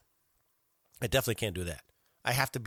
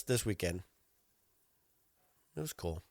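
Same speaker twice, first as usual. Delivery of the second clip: ordinary delivery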